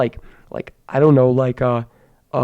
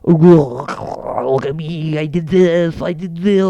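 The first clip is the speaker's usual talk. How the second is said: mock show-off; much slower than usual.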